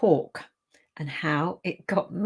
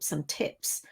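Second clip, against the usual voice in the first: whisper; 3 semitones lower, 8 dB quieter than usual. Real, not acted. Aside while offering help.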